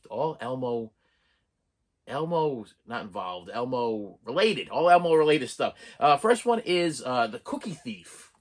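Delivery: ordinary delivery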